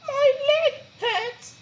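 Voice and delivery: very high, slow whiny whimper; whimpering sloth. A narrator's reading. Third sloth making an excuse, whimpering. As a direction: whine it like a sore little child. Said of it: "mumbled a third".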